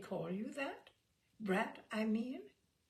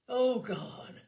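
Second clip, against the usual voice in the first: loud, exasperated groan; 5 semitones higher, 8 dB louder than usual. A narrator's reading. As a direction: groan it out, raised in pitch. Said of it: "Simon groaned".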